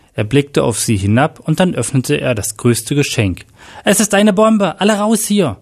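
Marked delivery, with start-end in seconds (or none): 3.9-5.6 high-pitched, urgent alarm; excited shout. A narrator's reading. Groom warns the guests of a bomb, shouting excitedly. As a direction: cry out a warning, voice pitched way up. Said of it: "schrie Jack aufgeregt".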